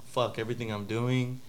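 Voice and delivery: ordinary talk, nothing marked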